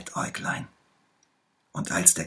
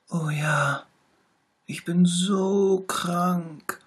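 high, slow, pitiful little tiger voice; sick little tiger; 12 semitones higher, 4 dB louder, much slower than usual. A narrator's reading. Little tiger complains to the bear, high and slow. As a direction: moan it high and drawn out, poor sick cub. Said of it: "rief der kleine Tiger".

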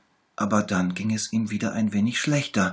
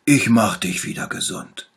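lightly voiced little bear, louder; 3 semitones higher, 9 dB louder than usual. A narrator's reading. Little bear promises to heal the tiger, louder. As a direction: promise it brightly like a small doctor. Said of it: "sagte der kleine Bär".

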